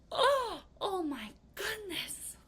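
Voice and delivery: high, slow amazed gasp of words; astonished. Pretend. A student showing what being astounded sounds like, high and slow. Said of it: "you're like".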